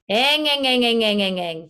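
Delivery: eng eng repeated like a motorcycle engine; twang eng exercise. Shown as a demonstration. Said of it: "Try for yourself".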